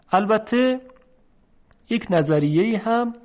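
normal talking voice

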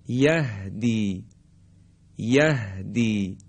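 یهدی slowly with a free breathy h; open, unconstricted h. Shown as a demonstration. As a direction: exhale the h of یهدی with the throat open. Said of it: "گرفتگی در حلق ایجاد نمیشه". (یهدی با ه بدون گرفتگی)

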